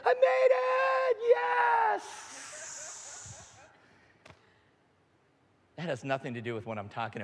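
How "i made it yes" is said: very high, drawn-out squealing cheer; triumphant shout. Acted. Surfer clearing the big wave, triumphant shout. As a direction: explode in hammed-up relief and victory.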